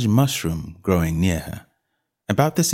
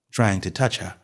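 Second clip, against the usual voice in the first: ordinary delivery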